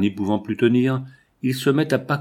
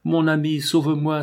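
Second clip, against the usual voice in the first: a higher, pleading cry for help; desperate cry; 6 semitones higher than usual. A narrator's reading. Sarah in Nicolas's dream, begging her friend for rescue, higher desperate cry. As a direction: call out to him in panic from the dream. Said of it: "et criant".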